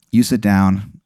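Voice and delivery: ordinary delivery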